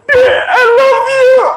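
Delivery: swooning husband cries out his love; overjoyed cry. Acted. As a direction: burst out in laughing tearful joy. Husband overjoyed at wife's reply.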